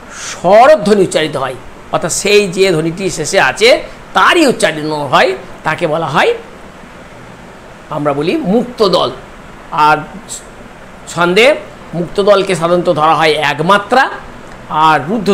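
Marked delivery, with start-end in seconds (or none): none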